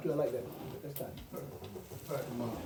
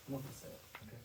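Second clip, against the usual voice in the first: whispered aside; 3 semitones lower, 13 dB quieter, faster than usual. Real, not acted. Stressing how few get rich.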